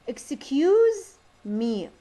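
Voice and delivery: very slow, exaggerated rise and fall on "Excuse me"; sing-song "Excuse me", voice climbing then dropping; up-and-down intonation. Shown as a demonstration. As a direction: EXCUSE swooping high and loud, then falling on ME. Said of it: "up and down".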